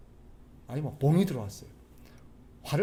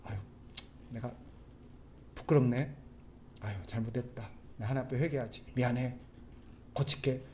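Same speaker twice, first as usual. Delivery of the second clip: low, soft, halting apology; acting a sheepish, humbled confession under the breath; contrite apology; 4 semitones lower, 10 dB quieter than usual. Husband caught drinking apologizes to his wife, contrite and hushed. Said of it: "어떻게 반응해야 돼요?".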